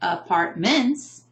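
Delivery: "apartments" wrongly as apart-MENTS; "apartments" slowly with misplaced stress on final syllable; stress on last syllable. Shown as a demonstration. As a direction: lean hard on MENTS in "apartments". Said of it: "and we don't say".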